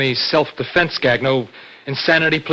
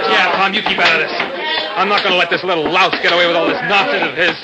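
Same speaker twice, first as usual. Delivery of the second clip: heated, quick, raised voice; angry refusal; 4 semitones higher, 4 dB louder than usual. Acted. Brother warning friend out of the quarrel, hot-tempered. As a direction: refuse to back down mid-fight.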